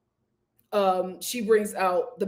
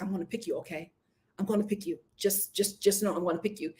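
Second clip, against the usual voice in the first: hushed promise; 4 semitones lower, 7 dB quieter, faster than usual. Retold or imitated. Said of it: "he's like".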